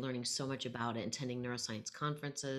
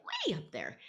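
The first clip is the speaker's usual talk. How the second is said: "Way" swooping way up high; mock high voice; 3 semitones higher than usual. Shown as a demonstration. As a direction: demonstrate a silly, cartoon-high pitch. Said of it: "I mock it with".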